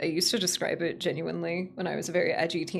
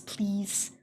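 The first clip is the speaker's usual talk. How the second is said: usual speaking voice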